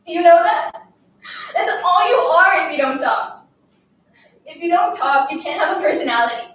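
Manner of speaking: loud, overwrought tirade; tearful scolding. Acted. Girl haranguing her silent classmate while crying, tearful scolding. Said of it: "I couldn't stop crying and talking at the same time".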